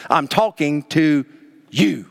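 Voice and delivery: slow, with YOU set apart after a pause; measured scolding, isolating "you"; emphatic YOU. Retold or imitated. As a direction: hold back, then land "you" on its own. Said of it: "you" so emphatic".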